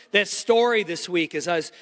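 ordinary delivery

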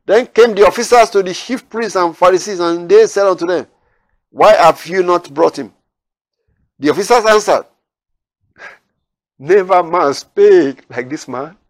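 loud, lifted Bible reading; preacher reading the Gospel aloud to his listeners, declaiming; scripture reading. Acted. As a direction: proclaim the verses to the congregation. Reads scripture verses aloud.

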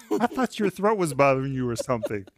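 a light laugh through the words; amused, half laughing at the excuse; laughing disbelief. Retold or imitated. Father teasing his son about skipping school, laughing.

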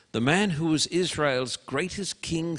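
ordinary talk, nothing marked

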